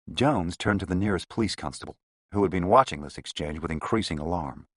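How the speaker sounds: ordinary delivery, nothing marked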